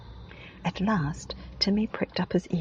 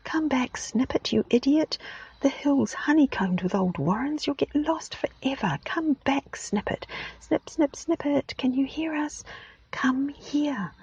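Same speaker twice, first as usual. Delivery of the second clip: much higher calls into the burrow; yelling down a hole; 9 semitones higher than usual. Acted. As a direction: call the dog back out of the warren. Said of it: "bent down by the hole and yelled".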